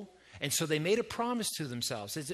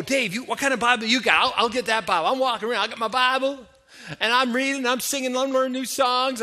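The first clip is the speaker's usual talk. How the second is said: raised, loud, breathless list of enthusiasm; eager new convert; 5 semitones higher, 5 dB louder than usual. Acted. New church member telling the pastor everything, acted excitement. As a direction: gush on and on like a keen newcomer. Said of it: "they're so excited".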